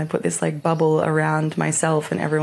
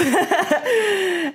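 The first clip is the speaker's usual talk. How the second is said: laugh. Real, not acted. Laughing after quote.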